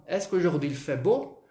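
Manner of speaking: "Est-ce qu'aujourd'hui il fait beau" rising on "beau"; questioning intonation. Shown as a demonstration. Teacher points students to the question tune, rising intonation. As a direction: ask in French with a final lift on "beau". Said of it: "Olha a entonação".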